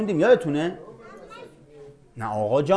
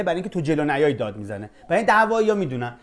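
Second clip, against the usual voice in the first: ordinary talk, nothing marked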